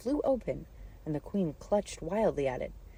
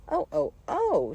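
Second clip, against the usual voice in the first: high, loud, drawn-out screams; shouted cries of pain; 12 semitones higher, 8 dB louder, slower than usual. A narrator's reading. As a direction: wail in alarm like a steam whistle. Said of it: "shouted the Queen".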